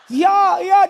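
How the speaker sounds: high, loud mock wail; hammed-up crying, drawn out; imitated sobbing. Weeping congregant answering her pastor, mock sobbing. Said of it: "dia nangis kejar air mata sama ingus".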